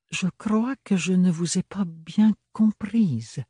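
sweet on the surface, steel beneath; honeyed. Acted. Bérénilde answers Ophélie's refusal, honeyed. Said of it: "dit doucereusement Bérénilde".

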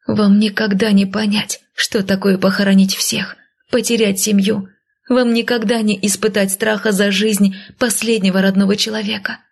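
plain reading voice

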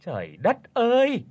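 high, indignant mother-in-law shriek; glaring question. Acted. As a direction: snap at the daughter-in-law in a high voice. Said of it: "quắc mắt hỏi". (mẹ chồng quắc mắt hỏi, giọng cao chói)

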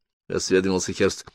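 usual speaking voice

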